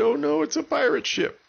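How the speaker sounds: high, frightened cry of alarm; scared people. A narrator's reading. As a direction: gasp it out in high-pitched alarm. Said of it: "they all started getting scared".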